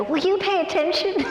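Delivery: high-pitched playful scolding; retold squeaky reprimand; mock exasperation. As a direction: pitch it way up, exasperated but teasing. Mock scolding after fourth interruption.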